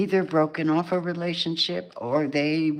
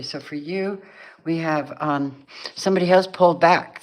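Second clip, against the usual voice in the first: ordinary talk, nothing marked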